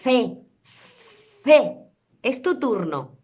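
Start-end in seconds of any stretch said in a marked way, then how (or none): 0.0-1.8 "C" as a long blown th, then a short loud vowel; th puis voyelle. Shown as a demonstration. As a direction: blow through the tongue, then pop the vowel in "C". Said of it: "decir la vocal que va después".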